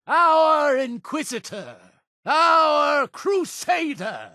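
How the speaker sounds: high-pitched sermon to a crowd; triumphant proclamation. Acted. Leader presenting the Crusader to brothers and sisters, high triumphant proclamation. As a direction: cry victory out to the congregation.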